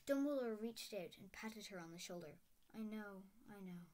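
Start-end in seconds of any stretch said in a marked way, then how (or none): 2.7-3.9 said heavily. A narrator's reading. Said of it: "he said heavily".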